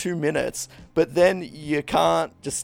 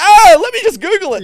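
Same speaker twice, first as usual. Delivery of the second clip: squeaky, much higher, louder panic; high panicked squeak; 12 dB louder than usual. Retold or imitated. Panicked pretend answer.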